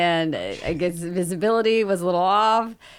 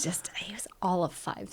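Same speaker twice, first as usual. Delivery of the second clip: whispered aside; 9 dB quieter than usual. Real, not acted. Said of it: "whispered aside about dad".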